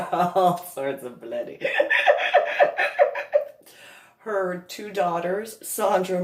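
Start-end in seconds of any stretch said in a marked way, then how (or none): 1.6-3.5 high, breathless squealing laugh; high wheezing laugh. Real, not acted. High squealing laugh at the bloody face. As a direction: laugh until the voice goes up and out.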